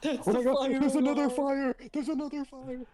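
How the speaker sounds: high, panicked cry; mock panic. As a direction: pretend alarm at the fire.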